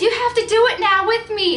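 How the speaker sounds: high, loud eager demand; pushing a friend to join in; excited insistence. Retold or imitated. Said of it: "she goes".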